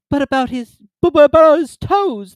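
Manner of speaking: high, loud, stammering insistence; won't let it go; persisting Mock Turtle. A narrator's reading.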